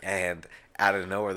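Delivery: normal talking voice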